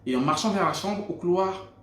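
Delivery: ordinary talk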